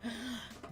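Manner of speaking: a sharp surprised gasp. Retold or imitated. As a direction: catch your breath in surprise. Surprised at introduction.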